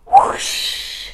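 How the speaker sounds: unpitched rushing whoosh noise; splashing whoosh. A narrator's reading. Car splashes their new hairdos, whooshing sound effect. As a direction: imitate a big splash with a hissing whoosh.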